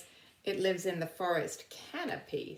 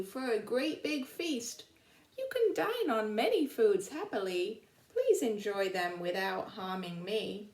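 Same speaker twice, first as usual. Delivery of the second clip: lively storybook poem reading; reading a poem aloud; 2 semitones higher, 4 dB louder than usual. Acted. Teacher reading an animal's poem to her class, lively storybook voice. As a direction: read it to the children like a rhyme.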